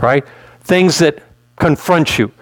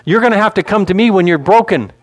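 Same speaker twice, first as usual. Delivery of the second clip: higher, insistent voice of God; God addressing Joshua; 6 semitones higher than usual. Acted. God telling Joshua to come for repairs, insistent. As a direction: press each point home as God. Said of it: "God is telling Joshua".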